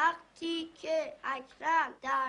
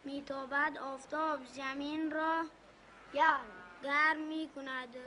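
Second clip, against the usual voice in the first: schoolbook reading; 4 dB quieter than usual. Acted. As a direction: sound out the primer line by line. Boy reads lesson aloud.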